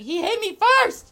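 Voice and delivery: outraged yell that he started it; indignant shout. Retold or imitated. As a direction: shout your defence. Indignant outburst defending himself.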